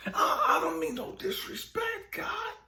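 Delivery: very high-pitched appeal; retold high-voiced plea to God; frustrated pleading. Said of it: "broken and confused and frustrated".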